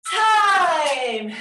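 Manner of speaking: loud called-out "Time"; shout. Real, not acted. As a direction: call time loud to the class. End of Tabata, calling out.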